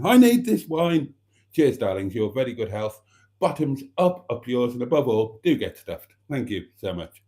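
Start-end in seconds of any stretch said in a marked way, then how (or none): 0.0-1.1 higher and louder, begging; louder, emphatic plea. Acted. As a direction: hit it harder the second time. Repeats it, louder.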